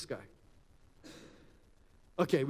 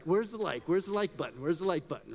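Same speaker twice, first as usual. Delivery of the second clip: eager mock search, pitch raised; excited; 2 semitones higher, 3 dB louder than usual. Workshop leader acts an eager phone user, excited. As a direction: act thrilled, hunting for the like button. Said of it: "So you gotta really get excited".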